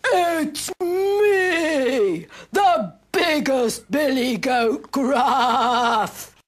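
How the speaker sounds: big gruff goat, slow and drawn out; bellowing billy goat. A narrator's reading. Eldest goat answers the troll, slow gruff bellow. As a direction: bellow it slowly, stretching the words. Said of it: "he bellowed".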